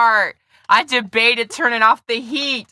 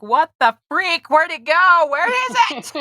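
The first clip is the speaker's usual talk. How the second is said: mock surprise; 9 semitones higher, 4 dB louder than usual. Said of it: "and I'm like".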